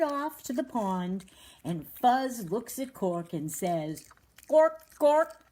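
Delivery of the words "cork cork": high, loud duck-like calls; duck quack imitation. A narrator's reading. Fuzz thinks ducks sound this way, mock quacking. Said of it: "that's the kind of a sound that a duck makes".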